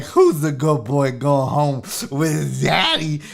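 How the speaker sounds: slow, cooing pet-parent voice; baby talk to a dog. Acted. New owner meeting a shelter dog's sweet face, cooing baby talk. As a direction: gush at an adorable dog in silly baby talk. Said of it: "you're like".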